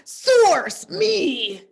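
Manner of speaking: high, loud SOURCE, me; dramatic announcer. Pretend. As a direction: proclaim it grandly, half laughing. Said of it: "I just can't stop saying it like that".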